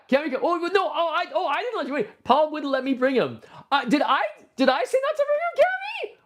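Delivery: high startled protest; surprised. Real, not acted. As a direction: caught off guard, defensive. Surprised reply to Cammy.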